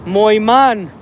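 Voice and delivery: a quick mock of the Afrikaans "Mooi man"; imitating Afrikaans accent. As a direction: echo the South African's "Mooi man" in his accent. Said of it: "The accent is legit".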